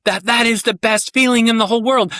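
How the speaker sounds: put-on high, loud child's delight; excited child voice. A child telling daddy his delight, high and loud. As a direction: gush like a kid who feels understood. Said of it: "They're like".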